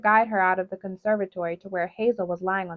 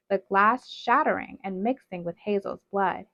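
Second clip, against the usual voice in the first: usual speaking voice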